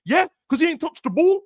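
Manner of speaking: high incredulous rebuttal; exasperated. Real, not acted. Mocking fans about Salah, high incredulous rebuttal. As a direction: push it up high in disbelief.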